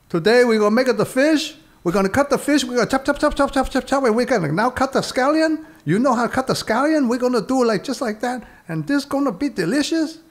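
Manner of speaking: high, put-on Chinese chef accent; exaggerated TV cooking show accent; Yan Can Cook impression. Chef demonstrating a recipe to the camera in a high put-on accent. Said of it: "Yan can cook".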